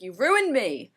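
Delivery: hammed-up you-ruined-me line; mock accusation. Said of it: "he's like".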